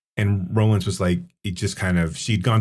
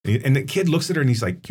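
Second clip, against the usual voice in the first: ordinary talk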